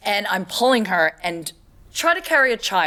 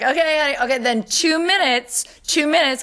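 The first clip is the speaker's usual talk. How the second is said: sing-song coaxing; 7 semitones higher than usual. Retold or imitated. Coaxing stubborn child at party.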